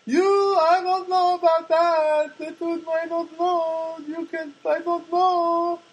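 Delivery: high, slow Czech mail lady voice; Czech accent impression. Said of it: "That's how she talks".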